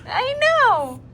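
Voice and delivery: high, loud cooing exclamation. Real, not acted. Mother answering her fussing baby, high and loud.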